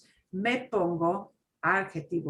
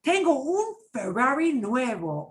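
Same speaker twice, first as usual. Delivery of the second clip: excited boast, higher and louder; bragging; 6 semitones higher, 4 dB louder than usual. Acted. Teacher playing friend bragging about a Ferrari, excited. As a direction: show off the new car. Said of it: "role-play".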